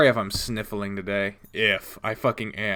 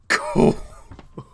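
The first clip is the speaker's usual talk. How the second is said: high squealing laugh; 4 dB louder than usual. Real, not acted. Laughing at the scene.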